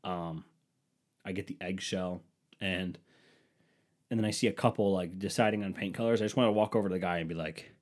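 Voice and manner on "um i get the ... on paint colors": ordinary talk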